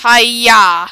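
loud martial-arts yell; kung fu shout. Acted. Player attacks the kidnapper with kung fu, loud battle cry. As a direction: let out a sharp kung fu cry.

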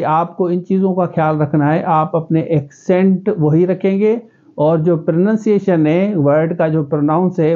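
ordinary talk, nothing marked

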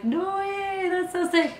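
high, delighted disbelief; excited. Retold or imitated. Telling the captain she can't believe it, thrilled. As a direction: squeal it like great news. Thrilled reply to sailing offer.

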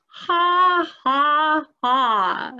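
loud, high, hammed-up ha ha ha; a sardonic stage laugh at herself; mock laugh. Mocking her own hope that life begins, staged laugh.

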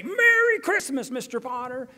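high, loud taunting holiday cheer; gleeful greeting. Retold or imitated. George greeting his enemy Mr. Potter, high, loud and gleeful. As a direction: yell it gleefully at your nemesis. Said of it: "knock on their window and say".

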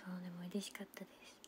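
soft whispered happiness. Real, not acted. Telling viewers she was happy, whispered. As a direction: whisper that it made you happy. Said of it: "whispered happy remark".

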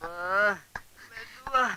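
high, soft whimper; sick moaning. Pretend. Patient begging for gentle rubbing, whimpering. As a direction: plead weakly in a small high voice. Patient being rubbed with balm.

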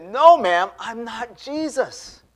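high, slow, drawn-out retold protest; emphatic denial. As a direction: protest it with exaggerated insistence. His exasperated repeated denial.

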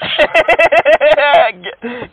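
long, high burst of laughter. Real, not acted. Scam baiter cracking up at scammer's mispronunciation, laughing. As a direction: crack up at the botched word. Laughing at the botched country name.

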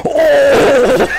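sudden loud yelp; startled shout. Real, not acted. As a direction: jump and shout in shock. Sudden close enemy, startled shout.

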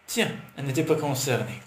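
ordinary delivery

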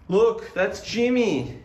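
high, sing-song voice for cats; pet talk voice. Real, not acted. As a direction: coo at the pets.

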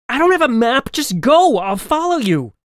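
high, loud, urgent order; exasperated shout. Acted. Boy holding off the old man, urgent shout to girl. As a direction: shout her onward, exasperated. Narrator shouting back while fleeing.

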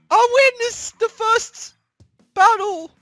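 high loud victorious cheer; elated cheering. Real, not acted. Gamer winning the first battle, elated high cheer. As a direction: cheer the win.

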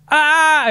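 high loud robot scream. Retold or imitated. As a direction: shriek back like a panicked robot. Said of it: "It just screams back at you".

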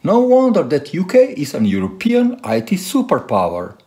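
ordinary talk, nothing marked

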